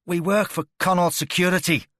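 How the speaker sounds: loud, high pained shout; desperate shout. A narrator's reading. Tortured captive shouts out who hired him, loud and desperate. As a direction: blurt the name out in agony. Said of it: "he shouted".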